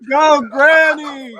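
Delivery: loud, high, drawn-out cheer; slow hollered cheer; cheering shout. Real, not acted. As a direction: whoop it out for granny. Cheering the granny in the story.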